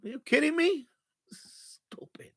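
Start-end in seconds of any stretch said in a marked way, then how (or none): 1.3-2.3 whispered. Real, not acted. Muttered insult to himself.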